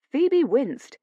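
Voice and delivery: plain reading voice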